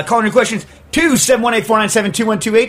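loud, high announcer burst; call-in announcement. Real, not acted. Host urging listeners to call in questions, loud announcer voice. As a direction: belt out the phone number.

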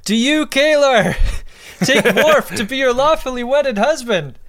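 high, loud hammed-up wedding vow; mock screaming. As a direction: bellow it like an over-the-top officiant. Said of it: "what Worf is screaming out to himself".

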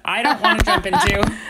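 real laugh breaking into the line. Real, not acted. To her cohost about ignorance, laughing loudly.

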